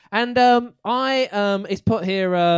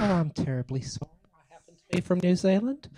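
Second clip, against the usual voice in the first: gentle put-on New Zealand accent. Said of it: "It's my New Zealand accent".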